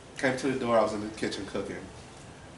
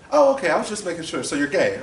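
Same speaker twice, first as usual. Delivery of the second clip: loud, high, quick imitation of an aunt; brisk acceptance; 9 semitones higher, 9 dB louder than usual. Aunt's brisk reply, acted.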